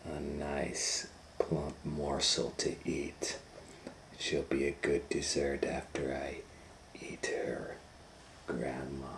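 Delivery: hushed, half-whispered hungry wolf; wolf thinking aloud. Acted. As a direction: scheme under your breath. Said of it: "the wolf thought to himself".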